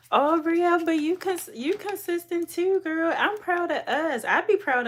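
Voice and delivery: high, loud gushing praise; excited gushing. Real, not acted. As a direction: gush over her loudly. Excited reply to viewer comment.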